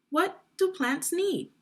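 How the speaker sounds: What do plants need, pitched high with do stressed; high question. Shown as a demonstration. Teacher repeating the title to children, pitched high. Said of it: "As I read, you will see a box".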